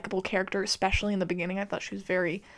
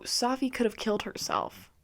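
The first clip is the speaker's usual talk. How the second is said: ordinary talk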